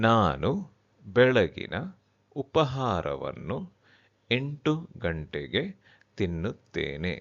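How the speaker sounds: word by word with pauses between; slowly. Shown as a demonstration. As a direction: spell it out slowly for learners. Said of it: "I will repeat that slowly".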